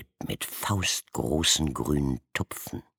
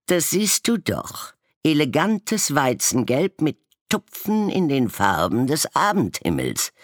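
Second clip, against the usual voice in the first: high, louder haughty raven voice; vain raven; 12 semitones higher, 5 dB louder than usual. A narrator's reading. Blue-spotted raven boasting to the snowman, high haughty voice. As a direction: show off your elegant colours.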